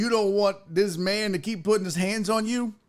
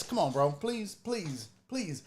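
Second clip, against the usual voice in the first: much softer, lower "please, please"; soft calm plea; 4 semitones lower, 10 dB quieter than usual. Shown as a demonstration. Demos the safe way, a much softer and lower calm plea. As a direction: defuse him quietly. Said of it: "but just do this".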